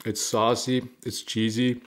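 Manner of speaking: chewing while talking; talking around food; mouth full. Real, not acted. Seen 2: chews a sandwich bite throughout, lips moving over food.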